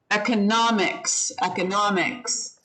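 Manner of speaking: economics with the stress on NOM, ec-o-NOM-ics; teacher modelling economics; stress on third syllable. Shown as a demonstration. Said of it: "so the third syllable".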